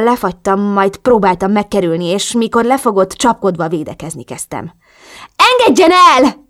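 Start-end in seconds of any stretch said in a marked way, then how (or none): 5.4-6.5 high, loud shriek to be let go. A narrator's reading. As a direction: squeal it out in protest. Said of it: "visítottam". (visítva, magas hangon)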